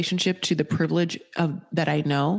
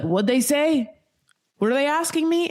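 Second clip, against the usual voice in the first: mock confused Rick; 8 semitones higher than usual. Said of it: "He's like".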